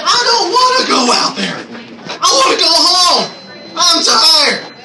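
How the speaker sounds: high, whining voice with a laugh; whiny complaint. Retold or imitated. Whiny inner thought, tired.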